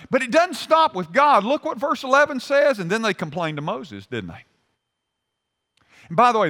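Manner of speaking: ordinary delivery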